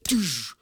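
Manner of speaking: loud, high punch sound effect; imitated punch impact. Storyteller showing how the punch landed, loud impact noise. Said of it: "You hear that contact".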